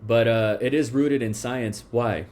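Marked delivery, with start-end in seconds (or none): none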